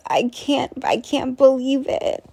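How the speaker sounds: ordinary talk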